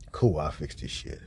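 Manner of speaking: low, soft offhand voice; mock reply. Agreeing to fix it, low muttered voice. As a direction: toss off the reply low and quiet.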